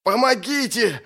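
high, straining plea for help; anguished cry for help. Acted. Trapped victim cries for rescue, anguished high cry. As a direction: cry out desperately, pitch leaping up. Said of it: "раздавался надрывный голос".

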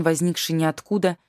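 plain reading voice

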